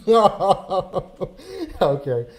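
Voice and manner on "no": high bursts of laughter while saying no; cracking up at the mix-up; laughing. Real, not acted. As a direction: laugh out the denial. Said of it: "laughing at the misheard word".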